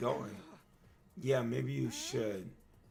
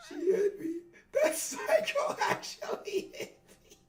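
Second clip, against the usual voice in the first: much higher, laughing whine; whiny laughing victim; 14 semitones higher, 8 dB louder than usual. Acted. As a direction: squeal it like a bruised brat. Character whining after being hit.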